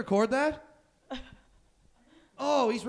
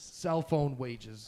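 slow mocking repeat; 9 semitones lower, 7 dB quieter, much slower than usual.

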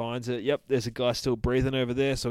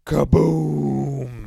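loud, drawn-out higher-pitched boom; explosion imitation; 5 semitones higher, 11 dB louder than usual. Telling how the dynamite lands and blows up, booming loud. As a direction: boom it out like a blast.

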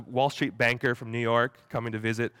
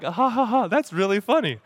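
fake laugh; 9 semitones higher, 5 dB louder than usual. Forced laugh at a prank.

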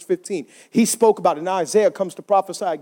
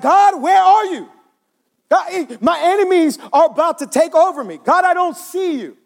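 desperate cry to God; 9 semitones higher, 3 dB louder than usual. Acted. Preacher as David calling to God, desperate. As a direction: call up to heaven, voice pitched high. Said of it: "David is like".

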